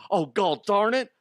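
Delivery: high, hammed-up anguished wail; man stuck in chimney. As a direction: curse your fate melodramatically, loud and high. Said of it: "little whispers he's saying to himself".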